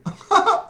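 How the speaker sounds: sudden high laugh; a quick bright giggle. Acted. Giggle after the flubbed line.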